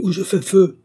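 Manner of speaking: high, loud threatening shout; shouted warning. A narrator's reading. Fugitive aiming his rifle at the riders, a loud shout. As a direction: bark the order to stop. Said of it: "proféra-t-il".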